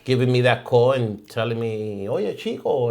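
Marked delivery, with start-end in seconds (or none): 2.1-2.9 exaggerated Cuban accent, higher. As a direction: Cuban accent, Caribbean Spanish flavor. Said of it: "In my best Cuban accent".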